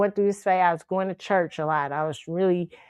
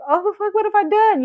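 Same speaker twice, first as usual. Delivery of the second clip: put-on shocked girl; 12 semitones higher, 4 dB louder than usual. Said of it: "she's looking like".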